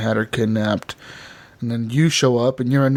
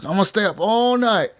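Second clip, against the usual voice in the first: hyped-up vow; 6 semitones higher than usual. Retold or imitated. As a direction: retell it as an eager boast, stretching the end. Retold hyped vow to himself.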